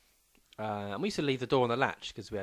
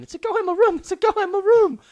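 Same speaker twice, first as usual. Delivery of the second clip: very high, loud retold shout; mock alarm at full volume; imitated panicked shouting; 15 dB louder than usual. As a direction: yell it like the terrified mate. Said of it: "we can hear him shouting".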